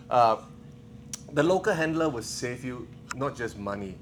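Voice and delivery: normal talking voice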